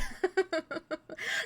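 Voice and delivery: high breathy laugh. Real, not acted. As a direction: burst into a laugh. Laughing at her failure.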